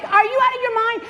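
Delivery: fast, high acted outrage; screaming at him. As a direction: yell it quickly as the frightened girl. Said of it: "she is like".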